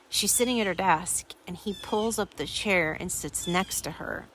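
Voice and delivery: ordinary talk, nothing marked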